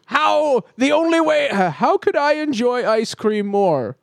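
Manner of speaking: sing-song mock of a company dreaming up ideas. Mocking company thinking aloud.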